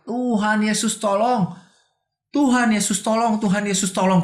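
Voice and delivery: high mock shout, held back in volume; retold cry for help. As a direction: re-enact calling out desperately to God. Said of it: "saya teriak".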